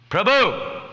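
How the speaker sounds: high, loud, scolding call; wrong-mood address. Pretend. Devotee calling another master in the wrong mood, sharp shout. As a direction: bark the honorific like a rebuke. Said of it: "not always with the right mood".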